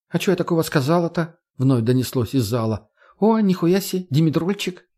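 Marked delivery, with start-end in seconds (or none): 0.1-1.4 feigned innocence. A narrator's reading. 3.2-4.8 excited high exclamation. A narrator's reading. Margo excited find.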